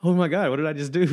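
retelling his horror at the photo he just sent, in mock panic; panicked. Friend retelling Bert's horror at sending the photo, hammed-up fast panic. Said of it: "And he's like".

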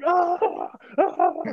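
high mock coach yell. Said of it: "their coach yelling".

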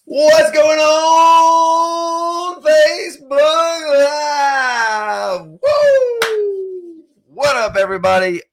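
high, loud, drawn-out showman call; stretched, sing-song announcer whoop; hype host shout. Acted. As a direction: belt it out like opening a live show. Show host opening the live episode.

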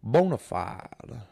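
put-on slow, stretched old Southern gentleman; lightly hammed-up old Southern drawl; old Southern accent. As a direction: savor each word like a genteel planter. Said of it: "He's like".

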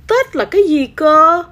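high, loud little-kitten question; innocent, high-pitched curiosity; puzzled kitten. A narrator's reading. Naive kitten asks what Tết is.

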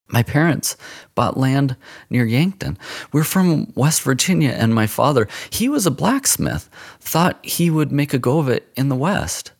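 put-on a boy eagerly pleading his family's case; boy's voice. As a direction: speak up like a nervous boy explaining himself. Boy's voice in the story.

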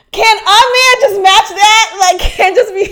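loud, high plea breaking into laughter; exasperated protest. Real, not acted. Protesting the usual advice.

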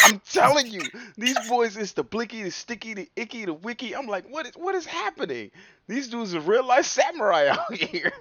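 fired-up storytelling about grime; excited rant. Real, not acted. Hyped about British grime.

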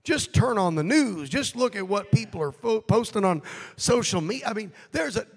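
loud, rising preacher's cry; preaching at full force. Acted. Preacher urging the congregation against anger, full force.

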